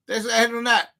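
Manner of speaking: ordinary talk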